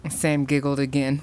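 usual speaking voice

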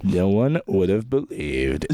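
low, slow, portentous narration; deep, measured voiceover; mock narrator. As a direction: intone it like a grave storyteller. Trading mock narrator lines.

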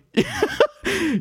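a sudden high, delighted laugh; real amused laugh; high burst of laughter. Real, not acted. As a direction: crack up at the absurdity. Laughing at the clip.

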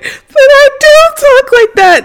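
very high, loud, drawn-out mock whine; mock whiny protest. Friend acting out Alyssa denying how she talks, mock whiny protest. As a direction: squeal it slowly on one high note, hamming up the denial.

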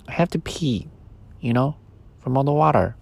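a tiny voice begging not to be left; small toy voice. Acted. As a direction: toy bear begging the boy, small pleading voice. Sleepy's voice.

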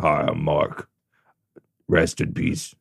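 low, deep hushed undertaker drawl. Retold or imitated. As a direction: rumble it out like a funeral director. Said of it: "his real ass voice".

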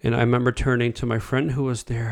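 ordinary talk

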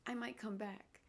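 usual speaking voice

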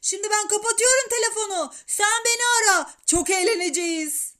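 high, very loud phone voice; eager boy shouting into the receiver. A narrator's reading. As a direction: yell the plan down the line. Shouted phone call continues.

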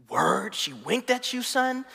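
hammed-up streetwise incredulity, rising at the end; mock amazement. Friend reacting to a wink story, mock amazed.

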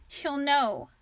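firm, stubborn repeat of her warning; a touch of stubborn conviction; insisting. A narrator's reading. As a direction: dig in and insist. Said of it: "Ava insisted".

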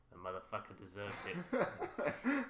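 exaggerated Boston accent, rising to a yelp; thick Boston cop voice, hammed up. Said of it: "That was my worst Boston accent".

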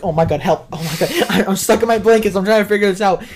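mock panic. Stuck in blankets, panicking.

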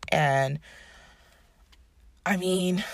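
ordinary delivery

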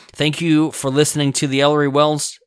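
upbeat radio host sign-off; podcast sign-off. Acted. Show sign-off.

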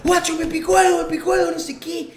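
high, loud mock yelling; hammed-up high-pitched alarm; retold panicked yell. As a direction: imitate a friend yelping in panic. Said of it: "se pone a gritar".

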